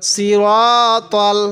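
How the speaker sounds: "সিরাতল" slowly, full s and long rounded ro; correct heavy raa. Shown as a demonstration. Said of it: "আমি কিভাবে পড়ছি".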